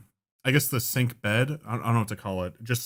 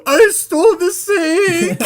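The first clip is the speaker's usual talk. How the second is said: high, slow, wailing confession; mock breakdown cry; cracking confession; 7 dB louder, much slower than usual. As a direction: wail it out as he cracks.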